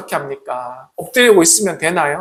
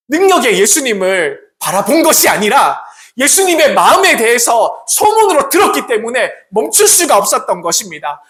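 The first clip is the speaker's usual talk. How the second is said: usual speaking voice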